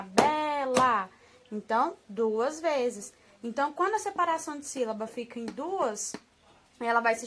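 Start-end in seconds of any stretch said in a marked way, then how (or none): 0.0-1.1 "bela" loud, in two beats as if clapping; sílabas com palmas. Shown as a demonstration. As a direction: beat out "bela" as be-la, one syllable per clap. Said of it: "Batendo palma lá". ("bela" forte, marcando as duas sílabas)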